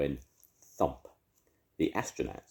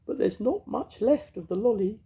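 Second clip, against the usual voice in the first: very high, louder fairy voice; comforting fairy; 5 dB louder than usual. A narrator's reading. Mavis comforting the ice lolly in a high fairy voice. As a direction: soothe him in a tiny high fairy voice. Mavis takes new wand.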